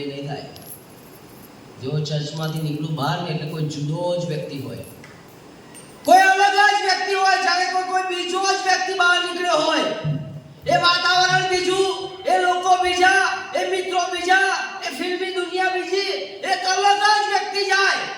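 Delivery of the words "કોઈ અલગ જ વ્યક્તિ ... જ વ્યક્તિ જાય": loud, driving pulpit delivery; preaching at full force. Acted. Preacher to congregation, forceful preaching. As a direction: hammer each line home to the church.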